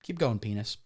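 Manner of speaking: soft, low, coaxing mock tenderness; gentle encouragement. Comic urging viewers to encourage themselves, soft and tender. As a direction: coax it softly like cheering on a pal. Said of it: "And go".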